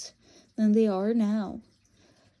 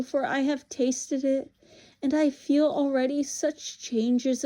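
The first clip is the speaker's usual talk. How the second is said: high, commanding witch voice, often loud; tempting witch; 4 semitones higher, 4 dB louder than usual. Acted. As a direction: urge the boy on with imperious fervour. Voices the witch urging him.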